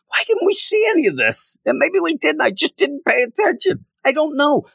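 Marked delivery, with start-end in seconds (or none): 0.1-1.6 very high, disbelieving question; incredulous outburst. Retold or imitated. Reviewer replaying his reaction to the comic, very high and disbelieving. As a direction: squeak it out in disbelief. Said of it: "I'm like".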